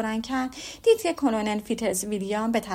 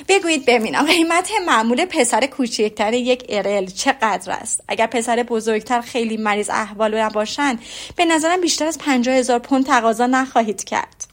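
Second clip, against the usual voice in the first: louder, animated teasing question; lively, excited tone; 8 dB louder than usual. A narrator's reading. Elizabeth teasing the Colonel about his price, excited. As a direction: push it out with bright mock eagerness. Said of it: "با لحن مهیجی گفت".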